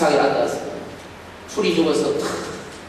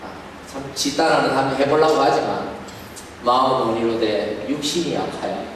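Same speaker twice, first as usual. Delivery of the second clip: usual speaking voice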